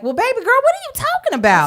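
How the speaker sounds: high, fast disbelief; incredulous. Retold or imitated. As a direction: react in sassy disbelief. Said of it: "was already like".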